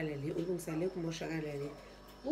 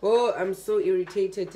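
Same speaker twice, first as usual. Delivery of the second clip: much louder, venting real irritation; loud irritated outburst; 10 dB louder than usual. Real, not acted. Vlogger venting to viewers about the bad ShopRite cup, loud irritated outburst. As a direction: blurt out the annoyance at full volume.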